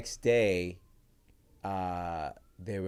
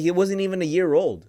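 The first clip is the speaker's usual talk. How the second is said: emphatic; 9 semitones higher, 9 dB louder than usual. Real, not acted. Insisting the puppy was young.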